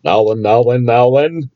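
lightly voiced dim copper; dim pompous cartoon cop. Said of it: "I'm not gonna say who I'm quoting there".

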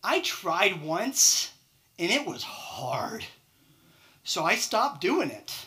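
slow, drawn-out whiny excuse; lazy excuse. Retold or imitated. Employee telling the manager he gave up, slow mock whine. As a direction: stretch it out like you can't be bothered. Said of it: "Or maybe they just tell you".

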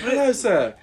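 high-pitched mock British accent; Tan France. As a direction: repeat the bad British accent impression, high and amused. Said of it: "try and do Tan France's accent".

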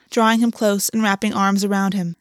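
plain reading voice

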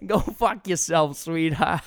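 drawn-out mock Boston drunk mom; broad Boston, edged with laughter; Boston accent. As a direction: imitate a boozy Boston mother. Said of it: "his mom who's drunk at the bar".

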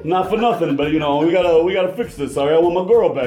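put-on high, fast New Jersey accent. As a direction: play a Jersey tough guy. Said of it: "thick New Jersey accent".

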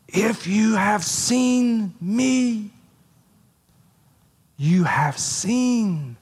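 slow right down and lean on every word; slow emphatic hush. Acted. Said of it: "very emphatic".

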